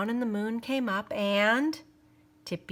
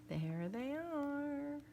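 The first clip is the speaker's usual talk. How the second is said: soft, slow, higher aside; hushed aside; 5 semitones higher, 15 dB quieter, much slower than usual. Real, not acted. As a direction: point them out softly. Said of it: "aside to listeners".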